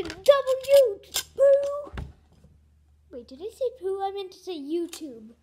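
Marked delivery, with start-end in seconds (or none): 0.0-2.0 loud, high giggly blurt; silly shout. Real, not acted. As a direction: blurt the silly word gleefully. Silly joke word blurted.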